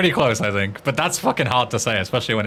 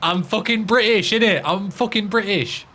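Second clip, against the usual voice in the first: higher-pitched, hammed-up British; mock British accent; 7 semitones higher than usual. Streamer excusing bad Swedish to a critic, hammed-up British accent. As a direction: play up being British as an excuse.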